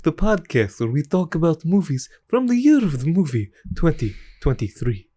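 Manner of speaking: spooky, theatrical vampire host; little vampire voice. Acted. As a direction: intone the intro like a campy vampire. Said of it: "this is a little vampire voice".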